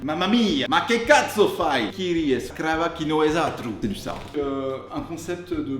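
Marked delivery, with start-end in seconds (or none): 0.0-3.7 loud, exaggerated Italian exclamations; hammed-up Italian outburst; over-the-top Italian. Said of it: "Ouais, je suis bilingue".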